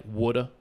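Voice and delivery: "woulda" said for water, with no final r; dropped-r local pronunciation. Shown as a demonstration. Friend showing how Key West locals talk, dropped-r "woulda". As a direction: say "woulda" with the ending softened to "uh". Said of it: "we'll say words like".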